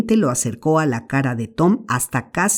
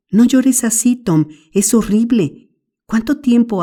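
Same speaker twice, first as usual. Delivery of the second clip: anxious little brother; 6 semitones higher than usual. Acted. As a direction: beg him to stop crying, scared. Sid voiced in the story. (Sid asustado, suplicando con voz más aguda)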